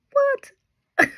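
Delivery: very high-pitched mock disbelief; incredulous squeak. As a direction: squeak it out, stunned by the figure.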